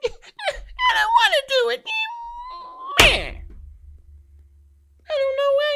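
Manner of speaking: high, loud mock whine of a sulking boy; whiny little boy. Mocking a sulking grown man, whiny high-pitched whine. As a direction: whine it like a pouting child. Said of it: "a fucking little boy who gets mad and be like".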